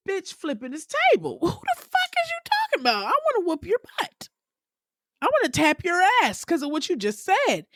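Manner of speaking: high-pitched outraged yell at the table; imitating an enraged reality star flipping the table; furious Erica. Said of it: "her initial reaction ... was".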